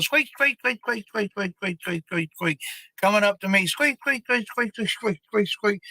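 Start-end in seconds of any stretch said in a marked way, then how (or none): 0.0-2.6 high, rapid repeated squeaks; squeaky toy imitation. Dog owner imitating his dog's chew toy, high squeaks. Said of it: "there's a squeaker in there". 3.7-5.8 high, rapid repeated squeaks; squeaky toy imitation. Dog owner imitating his dog's chew toy, high squeaks. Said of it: "there's a squeaker in there".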